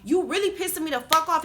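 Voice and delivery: retold angry outburst; getting loud. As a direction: shout back, thoroughly pissed off. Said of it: "So I start getting loud".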